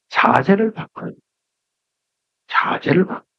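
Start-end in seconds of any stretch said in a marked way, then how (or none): 2.5-3.2 자세를 바꿔요 breathed out in a whisper; 자세를 바꿔요 whispered softly. Shown as a demonstration. Repeats the phrase, second hushed.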